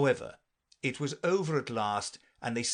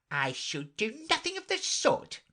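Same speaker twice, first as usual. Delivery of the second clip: offended mouse; 11 semitones higher than usual. Acted.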